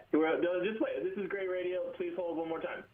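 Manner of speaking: tinny, distant phone voice; like talking through a soup can; far from mic. Real, not acted. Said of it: "soup can up to your phone".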